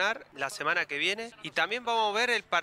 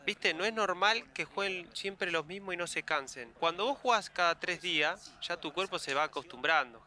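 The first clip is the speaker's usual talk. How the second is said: ordinary delivery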